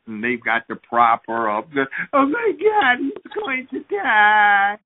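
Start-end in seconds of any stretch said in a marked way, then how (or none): 2.1-4.8 shrieking high, stretched out slowly; hammed-up panic over the hurt child; hysterical mother. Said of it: "she's about to faint".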